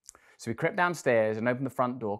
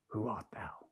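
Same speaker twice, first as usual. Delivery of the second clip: awed whisper; 3 semitones lower, 9 dB quieter than usual. Acted. Giant in awe.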